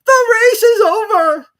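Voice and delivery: very high, loud, slow shout; calling out. Acted. Dodo ending the race, high loud shout. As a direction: cry it out to everyone. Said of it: "the Dodo suddenly called out".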